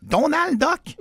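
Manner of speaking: high squeaky Donald Duck quack on Donald Duck?. Pretend. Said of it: "J'ai de l'air de qui, moi? Daffy Duck?".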